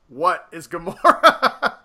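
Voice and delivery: very slow, flat-footed question; slow, deadpan imitation of Drax. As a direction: drag it out like a literal-minded brute. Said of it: "And Drax goes".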